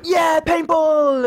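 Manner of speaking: put-on high, slow excited cheer from a coach of young scouts; kids cheering. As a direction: yell it like an eager Cub arriving at paintball. All these lads come off going.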